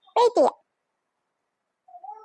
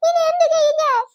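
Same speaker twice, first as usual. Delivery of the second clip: despairing wail; 8 semitones higher, 4 dB louder than usual. Acted. Despairs over what to do.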